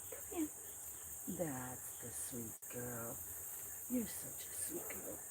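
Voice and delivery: soft tender coo; tender hush. Real, not acted. Owner softly praising the kitten, tender hush.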